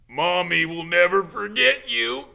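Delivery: high loud tearful vow; sobbing Hagrid. Acted. Hagrid sobs to baby dragon, high and loud. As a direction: cry it to the crate.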